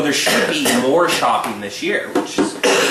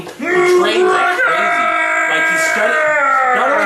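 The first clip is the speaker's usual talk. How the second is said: excited shout; 14 semitones higher, 4 dB louder, slower than usual. Real, not acted. Excited about fighter's training.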